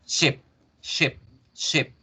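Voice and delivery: "ship" with a lax i leaning toward eh; English "ship", short vowel, no smile; thicker English short I. Shown as a demonstration. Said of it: "se engruesa un poco más la voz".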